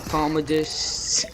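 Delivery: normal talking voice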